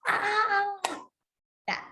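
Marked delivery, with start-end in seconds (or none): 0.1-0.7 high exploding yell; exploding shout. Shown as a demonstration. A teacher showing anger exploding with a yell. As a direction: burst like a shaken soda can. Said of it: "You are just like".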